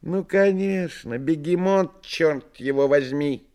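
high, nasal, nasty voice; a sneering nasal whine; nasal unpleasant voice. Acted. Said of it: "Другой неприятный и гнусавый голос".

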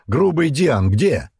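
loud, high, drawn-out shout; barked. A narrator's reading. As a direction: bellow for someone out of sight. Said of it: "гаркнул в спину Остах". (громкий протяжный окрик)

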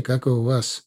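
ordinary delivery, nothing marked

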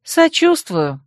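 high-pitched, falling curt sympathy; grudging one-word condolence; mild grumble, starting high; grumbled. A narrator's reading. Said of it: "буркнула я".

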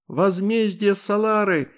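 loud, high, slow proclamation; booming battle cry. A narrator's reading. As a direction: thunder it like a hero. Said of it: "громогласно выкрикнула Астрид".

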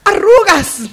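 very high shocked cry at the word arrugas; horrified shriek. Acted. Adriana reacts to being warned about wrinkles, shrieking high. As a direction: shriek as if wrinkles were a catastrophe.

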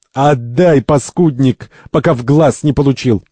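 angry threat. Acted. Second fights Santori for the apple, threatening. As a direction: threaten him into giving it up.

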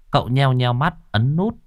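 ordinary delivery

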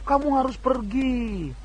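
slow, hushed, eerie command; a ghostly voice giving an order, drawn out slowly; hallucinated command voice. Acted. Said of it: "Misalnya dia mendengar perintah begitu ya".

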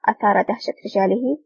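usual speaking voice

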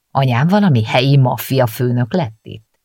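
plain reading voice

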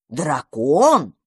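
drawn-out astonished question; surprised. A narrator's reading. Magpie learns it is a dragon, surprised. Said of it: "удивилась сорока".

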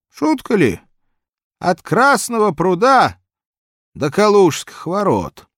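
put-on high, loud old man voice. Old man complains of distance.

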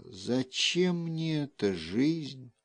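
soft, slow tearful whisper; whisper sobbing. A narrator's reading. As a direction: whisper it through silent tears. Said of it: "срывался с её губ шёпот".

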